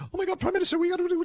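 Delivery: high, frantic, urgent aide voice; panicked aide. Retold or imitated. Flustered aide interrupting the Prime Minister in alarm, high and frantic. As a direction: burst in squealing with alarm.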